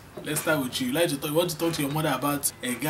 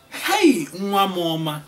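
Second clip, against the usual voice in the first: much higher, louder delighted hey; excited mother; 3 semitones higher, 6 dB louder than usual. Acted. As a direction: cry out in proud delight. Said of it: "I will say it again".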